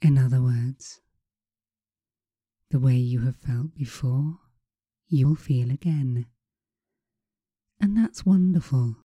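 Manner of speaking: slow, soothing hypnotist voice. Acted. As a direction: guide them slowly into calm. Leads hypnosis session.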